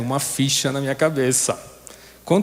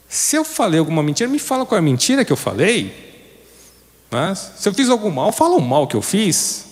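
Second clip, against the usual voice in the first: higher, rising indignant plea; Jesus questioning; 5 semitones higher than usual. Retold or imitated. Preacher voicing Jesus to the man who struck him, rising indignation. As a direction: voice Jesus pressing calmly then rising. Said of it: "E ele simplesmente responde assim".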